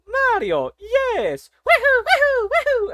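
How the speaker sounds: very high, loud gleeful plumber whoops; Mario voice. Acted. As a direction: cheer like the cartoon hero taking off. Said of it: "Mario voice impression says".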